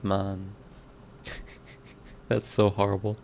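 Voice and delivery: ordinary delivery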